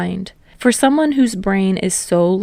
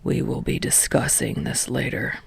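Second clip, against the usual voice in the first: low, much softer tired voice; weary teacher; 8 semitones lower, 9 dB quieter than usual. A narrator's reading. Exhausted teacher fed up with class. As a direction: sigh it out low and worn.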